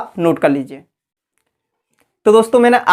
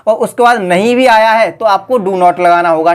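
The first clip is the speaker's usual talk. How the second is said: ordinary delivery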